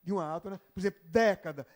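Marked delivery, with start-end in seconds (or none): 1.0-1.7 década, primeiro é aberto e tônico; década com é aberto. Shown as a demonstration. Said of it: "tem que ser aberto porque é tônico". (década com é aberto)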